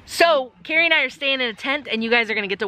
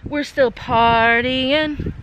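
slow; 3 semitones higher, much slower than usual. Acted. Slowed party line.

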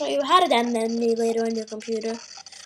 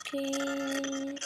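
ordinary talk

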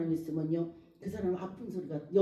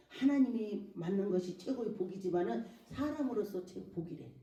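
ordinary delivery

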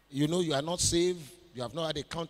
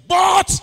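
"But" shouted high and very loud; one explosive, high-pitched "But"; shouted emphasis; 12 semitones higher, 17 dB louder than usual. Real, not acted. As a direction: burst out on "But" as the turning word. Preacher hammers the pivotal word.

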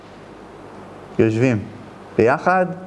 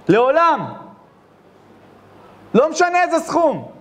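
high, forceful sermon delivery; preaching; 11 semitones higher than usual. Acted. Preacher scolding the son who disrespects his mother, fervent. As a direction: drive the moral home like a rabbi.

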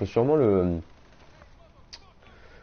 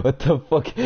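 English with a thick French accent, slightly raised pitch; poor English accent; 4 semitones higher, 4 dB louder than usual. Real, not acted. Streamer mocking his own English, French accent. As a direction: say it in shaky French-learner English. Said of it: "mon anglais n'est pas, euh, très".

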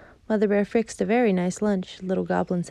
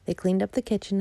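plain reading voice

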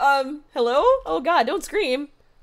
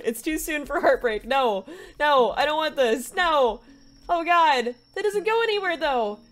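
ordinary talk, nothing marked

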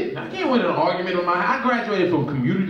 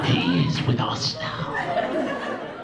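very slow, low creepy whisper; demonic whisper; 7 semitones lower, much slower than usual. Retold or imitated. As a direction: drag it out like a cult chant. Mother-in-law possessed voice.

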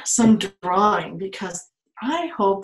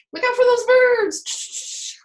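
much higher, slow mock warning; 6 dB louder, slower than usual. Teacher warning the flying car about birds, high and playful.

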